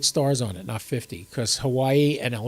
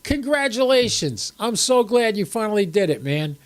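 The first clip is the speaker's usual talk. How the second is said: excited congratulations; 6 semitones higher than usual. Real, not acted. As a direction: congratulate a friend, genuinely thrilled. Congratulating a viewer on first contact.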